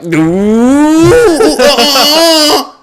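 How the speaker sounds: high, loud, sing-song mocking disapproval; high loud mock tut-tut; mock disapproving ooh. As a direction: sneer it like a judgy fan.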